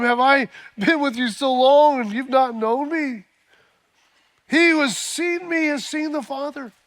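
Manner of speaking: high, loud fervent preaching; preaching at full force. Acted. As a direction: lift the whole room with it.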